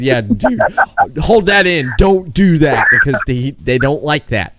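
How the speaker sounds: 1 urging friend. Retold or imitated. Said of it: "I said".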